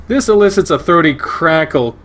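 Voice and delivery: ordinary delivery, nothing marked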